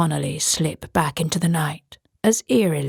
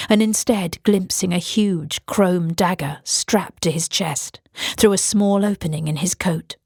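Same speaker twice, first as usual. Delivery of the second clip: plain reading voice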